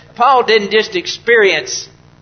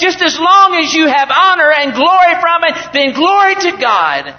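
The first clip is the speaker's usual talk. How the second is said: rising higher and louder into a preached shout; preacher shouting a fervent model prayer; impassioned prayer shout; 7 semitones higher, 4 dB louder than usual. Acted. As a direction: cry out to the Lord at full force.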